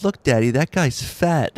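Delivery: put-on loud little kid pointing something out; retold child. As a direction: imitate a child blurting out to dad. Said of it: "and he goes".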